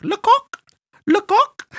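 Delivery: falsetto French buffoon, very high; high-pitched French voice. Retold or imitated. Clown showing a chicken picture, high-pitched French voice. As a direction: imitate a squeaky French clown. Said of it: "in this high-pitched voice".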